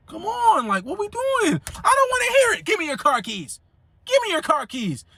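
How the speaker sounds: high mock mama voice cutting him off; high scolding mother demanding the keys; mom scolding. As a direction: pitch it up as the angry mother. Mom scolding grown son.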